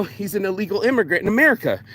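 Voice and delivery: ordinary talk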